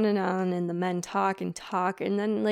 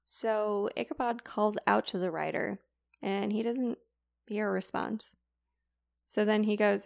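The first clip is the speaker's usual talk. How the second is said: ordinary delivery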